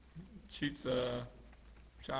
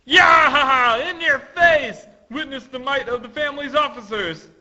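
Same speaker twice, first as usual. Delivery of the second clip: loud, high crowd cheer; rowdy, shouted cheering; 10 semitones higher, 11 dB louder than usual. Acted. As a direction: whoop and gloat from the sidelines. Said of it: "people on the sidelines cheering".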